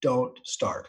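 soft, desperate little plea; begging the bike. Retold or imitated. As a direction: quietly beg the motorcycle not to start. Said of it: "and I'm begging".

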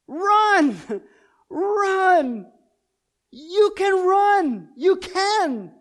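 loud, tearful voice of Jesus pleading; Jesus urging on. Acted. Jesus calling to the weary runner, loud and tearful. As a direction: cry out the plea to keep running.

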